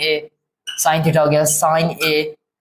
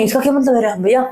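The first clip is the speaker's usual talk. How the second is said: lightly voiced student, pitched higher; puzzled student; 5 semitones higher, faster than usual. Student asks the teacher what this means, lightly acted higher voice. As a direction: ask as a mock-puzzled pupil, higher than usual. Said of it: "अब बोलेंगे".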